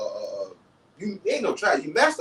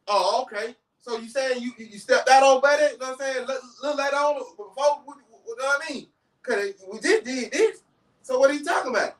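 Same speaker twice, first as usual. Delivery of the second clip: retold heated questioning; 6 semitones higher than usual. Acted quarrel, imagined confrontation.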